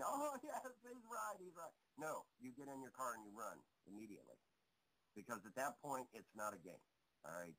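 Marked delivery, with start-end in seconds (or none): 0.1-1.7 high-pitched mock yes-man; high, sarcastic imitation of agreeing experts; mocking agreement. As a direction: parody fawning agreement, starting high and falling away. Mimicking scientists who agree with each other.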